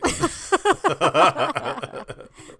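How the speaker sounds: high shrieking laugh. Real, not acted. Laughing after allegedly.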